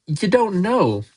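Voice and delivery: high swoop that falls at the end; stunned repetition. A narrator's reading. Hagrid taking in Harry's ignorance, stunned. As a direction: repeat it as it sinks in.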